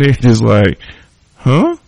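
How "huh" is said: high confused huh; utterly puzzled; baffled. Retold or imitated. Baffled reaction.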